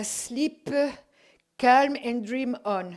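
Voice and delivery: reading English slowly in a light French accent; carefully sounding out an English slogan, slow. Real, not acted. Reading the pyjama slogan aloud in a French accent. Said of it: "moi et l'anglais, on fait deux".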